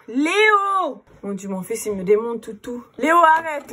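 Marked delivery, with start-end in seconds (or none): 0.1-1.0 calling out. Real, not acted. Mother interrupted mid-question by her son, calling out to him. 3.0-3.7 raised, higher-pitched real telling-off; scolding call. Real, not acted. Mother to the son who keeps messing things up, scolding call. Tells her son to stop.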